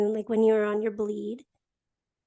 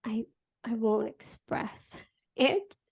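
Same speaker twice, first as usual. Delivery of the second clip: sheepish laugh; 5 dB quieter, slower than usual. Real, not acted. Admits holding thoughts back.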